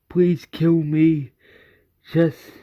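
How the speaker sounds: slow, loud acted plea; mildly anguished repeated begging; insistent begging. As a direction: plead with drawn-out words. Morgan keeps begging to die.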